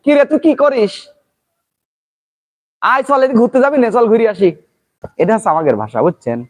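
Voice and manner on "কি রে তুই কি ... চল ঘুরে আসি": casual Pabna dialect, brisk and chatty. Shown as a demonstration. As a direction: talk like a local from Pabna calling a friend. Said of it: "আমার এলাকার ভাষা যেমন কই".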